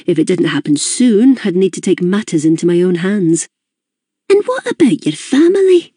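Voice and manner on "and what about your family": high, kindly Glaswegian mum voice; high, curious mother's voice; Mrs Gibbons. A narrator's reading. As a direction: voice the chatty older Scottish hostess, pitched high.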